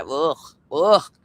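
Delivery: slow, drawn-out ugh of revulsion; disgusted groan. Real, not acted. Streamer who can't stand that voice, groaning in disgust. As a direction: groan twice in real disgust.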